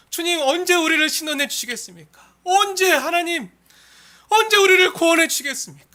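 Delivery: high, loud imploring prayer; crying out. Acted. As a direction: wail out a plea to God. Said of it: "울부짖는 거예요". (높은 소리로 울부짖는 기도)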